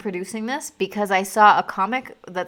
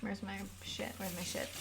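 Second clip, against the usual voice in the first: muttered to self; 16 dB quieter than usual. Real, not acted. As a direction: mutter it under your breath.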